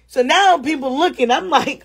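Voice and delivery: ordinary delivery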